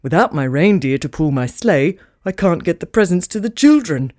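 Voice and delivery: a touch of despair in Santa; glum Santa. Acted. Santa stumped without his reindeer, glum low voice. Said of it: "said Santa glumly".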